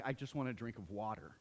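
low, soft and pleading for water; parched longing. Retold or imitated. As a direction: beg quietly, worn down. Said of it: "he's like".

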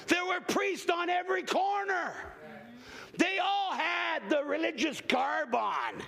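slow, high, shouted sermon cadence; full-force preaching. Acted. Preacher asking the congregation why the woman touched Jesus, shouting.

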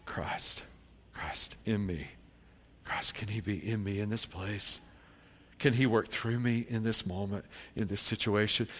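low, soft searching question; quiet prayerful wondering; hushed reflection. Acted. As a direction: ask it inwardly, with pauses. Hushed self-questioning in sermon.

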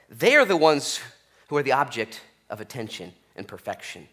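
ordinary talk

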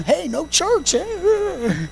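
high, swooping glee at a day off; mock carefree churchgoers. Pastor imitating members after canceled service, high mock glee. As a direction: imitate folks pleased to skip church. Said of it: "They were just looking".

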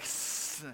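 long unvoiced hiss like a snake. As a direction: hiss through the teeth. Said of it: "He started to hiss at me".

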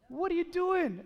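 exasperated, incredulous challenge; indignant. Retold or imitated. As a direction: call out a rude moviegoer, pitch jumping up. Acted reaction to rude phone user.

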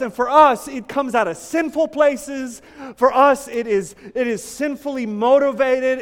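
high, loud impassioned preaching; preaching at full force. Real, not acted. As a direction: pour it out to the congregation.

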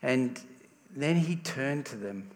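usual speaking voice

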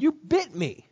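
hurt, angry accusation; betrayed girl crying out. A narrator's reading. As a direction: cry out in shock at the betrayal. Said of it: "threw it on the ground and said".

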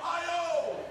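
high, laughing crowd call; rowdy fans answering chant; drunken crowd reply. Retold or imitated. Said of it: "drunk people yell out".

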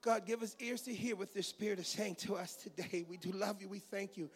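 ordinary delivery

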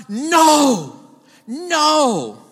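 NO, NO shouted loud and high, hammering each no; emphatic no. Shown as a demonstration. Preacher to congregation, stressing the no: shouted "no, no". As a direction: slam down every "no" like a verdict. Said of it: "There's a strong emphasis on the no".

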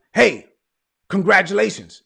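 imitating a loud, hearty congratulation; retold congratulations. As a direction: announce the good news loudly, as retold. Voices the young man congratulating him.

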